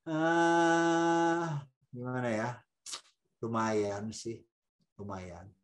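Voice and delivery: feeling person dodging an honest verdict, hesitant; hesitant hedging. Acted. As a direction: stall so you need not say it is ugly. Feeling person avoids saying ugly.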